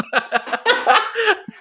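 a real burst of high laughter; high squealing laugh. Real, not acted. Cohost cracks up at his own joke, high squealing laugh. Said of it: "laughing fit".

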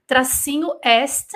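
slow spelling out of "nor" and "est"; slow dictation. Shown as a demonstration. A teacher dictating the spelling of nord-est slowly. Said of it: "mas não fala nordeste".

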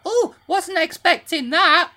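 high, slow, prim mum voice; man doing a woman's voice. Retold or imitated. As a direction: put on your mum's surprised voice. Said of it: "why is that your impression of your mum?".

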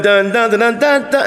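sung dun-dun jingle, rising at the end; news jingle. Shown as a demonstration. Mocking the news show's ending jingle, sung. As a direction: hum the news outro tune. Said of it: "And dun".

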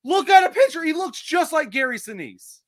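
high, loud, worked-up insisting; excited insisting. Real, not acted. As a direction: push the point, pitch way up. Heated insistence with friends.

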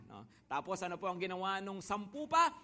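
ordinary talk

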